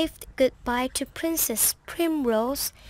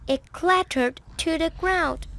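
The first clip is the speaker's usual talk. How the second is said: ordinary delivery, nothing marked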